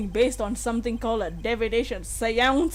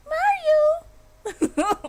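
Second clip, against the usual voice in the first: very high squeal of Mario; Princess Peach impression; 3 dB louder than usual. Streamer trying out a Peach voice, high squealed cry. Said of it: "Like Princess Peach?".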